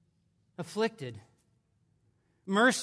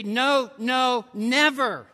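emphatic denial; 2 semitones higher, 5 dB louder, slower than usual. Retold or imitated. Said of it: "loosely translated, it could be".

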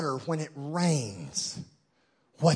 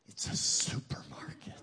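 whispered emphasis; 10 dB quieter than usual. Shown as a demonstration. Pastor contrasting market and supermarket, whispering. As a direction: whisper it, no voice at all. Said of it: "It's not just a market".

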